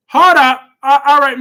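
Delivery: loud interrupting outburst; sudden loud stop. Real, not acted. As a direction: hold up, burst out loud and sharp. Scolding chat member for swearing.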